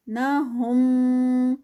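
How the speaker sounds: slow drawn-out tajweed recitation, vowels held; Quran recitation. Shown as a demonstration. Tajweed teacher reciting a verse for students, slow melodic recitation. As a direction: chant each word with its lengthening. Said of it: "اخفاء شفوی ہے".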